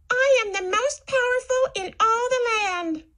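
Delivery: very high, boastful villain. Shown as a demonstration. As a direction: squeal out a boastful brag. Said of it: "say it in a voice to match who's talking".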